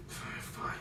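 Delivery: whispered grudging fine; sulky whisper. Acted. Lords give in to Rand in a whisper. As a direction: breathe it out resentfully.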